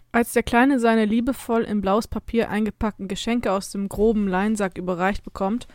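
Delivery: ordinary delivery, nothing marked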